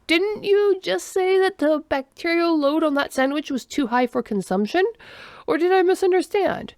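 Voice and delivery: high-pitched puzzled questioning; confused. A narrator's reading. Alien questions friend eating bad sandwich, confused. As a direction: query it as a baffled, formal alien. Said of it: "making sure to put confusion in his tone".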